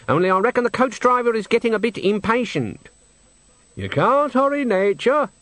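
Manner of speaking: put-on a chirpy old witch unbothered in the bushes; Nanny Ogg voice. Nanny teasing Granny from behind the gorse, high-pitched.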